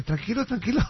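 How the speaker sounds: wife calming her panicked husband; soothing. Retold or imitated. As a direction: hush him down gently.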